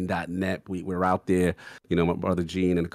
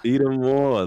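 louder, higher, hyping up the name; hyped announcement; 6 semitones higher, 6 dB louder, slower than usual. Shown as a demonstration. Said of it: "the legend".

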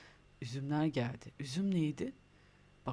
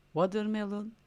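called-out model word; 6 semitones higher, 5 dB louder than usual. Shown as a demonstration. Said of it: "sen watermelon diyeceksin".

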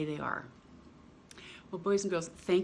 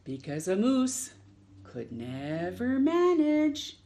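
lively picture-book read-aloud with long pauses; reading to children; slower than usual. Acted. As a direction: read it like story time for little ones.

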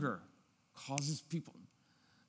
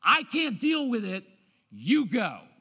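loud, higher dismissal; louder fed-up dismissal; fed-up parent; 6 semitones higher, 9 dB louder, slower than usual. Shown as a demonstration. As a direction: send them off, at the limit. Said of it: "We all do it".

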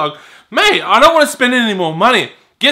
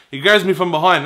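usual speaking voice

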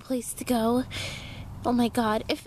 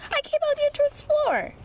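indignant insistence; 12 semitones higher than usual. Acted. Insisting on exploring.

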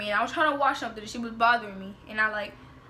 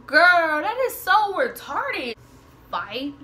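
loud, higher, drawn-out scorn; scoffing disbelief; 4 semitones higher, 6 dB louder, slower than usual. Real, not acted. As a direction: stretch it out, loudly dismissive. Scoffing at the fight challenge.

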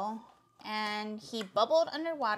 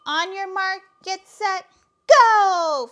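race start call; 6 semitones higher, 11 dB louder, slower than usual. Acted. Starting a swimming race at the pond, building shout. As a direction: build to a long, loud "go!".

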